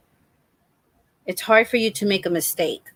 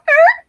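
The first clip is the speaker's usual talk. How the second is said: loud, high burst of car noise; imitated engine screech; 8 dB louder than usual. Showing how the tailgater drove, loud high screech. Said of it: "they start going".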